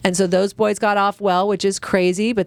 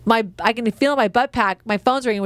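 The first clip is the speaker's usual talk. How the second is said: ordinary talk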